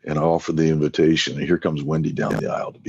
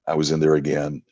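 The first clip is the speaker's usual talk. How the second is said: ordinary talk, nothing marked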